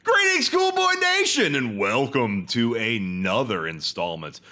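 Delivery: high, drawn-out announcer delivery; hammy show intro. Acted. Host greeting Cool Boy Nation, hammy announcer. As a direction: belt out a mock-grand podcast greeting. Reads the show's scripted greeting.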